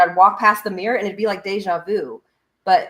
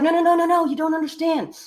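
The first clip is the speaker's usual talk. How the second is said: excited insisting; 9 semitones higher than usual. Retold or imitated. Friend eagerly insisting to doubtful listeners, much higher and excited. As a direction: cut them off, eager to explain.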